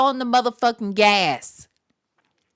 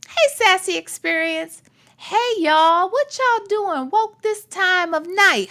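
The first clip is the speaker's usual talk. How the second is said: playful greeting; 6 semitones higher than usual. Real, not acted. Greeting late chat playfully.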